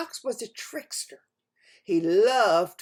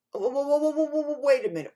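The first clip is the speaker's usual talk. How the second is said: rapid-fire, raised, urgent halt; hurried interruption; 5 semitones higher, 4 dB louder, much faster than usual. A narrator's reading. Bear stops the fox leaving, rapid and urgent. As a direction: cut in fast, higher and louder.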